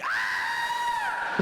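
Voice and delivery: very high, loud cashier shriek; mock shriek of horror. Cashier handed a Scottish note, high loud shriek.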